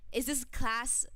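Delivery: ordinary talk, nothing marked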